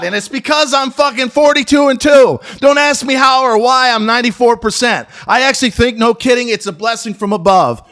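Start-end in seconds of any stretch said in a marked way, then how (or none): 0.0-4.6 higher, louder bragging burst; boastful shout. Real, not acted. Vlogger bragging to viewers about his trading record, loud and proud.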